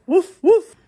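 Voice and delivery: imitated dog woofs; loud mock barking. Likening the following coworker to a dog, loud barking. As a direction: bark it out like a pestering dog.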